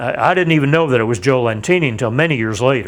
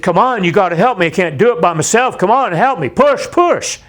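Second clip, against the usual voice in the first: louder, higher, pleading push; urgent retold plea; 6 semitones higher, 3 dB louder than usual. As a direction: urge a half-conscious man to push. Urging trapped Joe to push.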